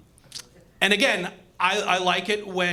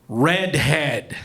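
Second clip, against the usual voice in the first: disgusted; 5 semitones lower, 4 dB louder than usual. Acted. Spoken with disgust contrast.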